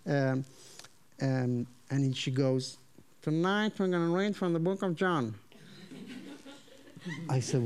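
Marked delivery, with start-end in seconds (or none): 3.2-5.3 put-on strong Southern accent. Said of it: "they have a very strong Southern accent".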